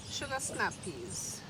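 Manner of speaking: ordinary delivery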